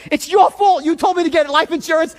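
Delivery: loud, high accusing yell; livid. Retold or imitated. Nephew yelling blame at his aunt, loud and livid. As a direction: blame her at full volume. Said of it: "I'm livid".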